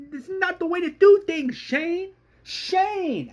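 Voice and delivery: very high, strained plea; acted screaming. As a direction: plead in a high cry. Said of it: "as John Miller is screaming".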